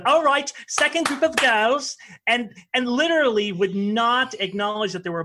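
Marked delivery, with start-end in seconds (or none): 0.1-2.0 higher, louder mock teacher calling out; lightly playing the cold teacher addressing the girls; imitating the ballet teacher. Ballet teacher announcing the girls' group, mock-haughty. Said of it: "she'd go".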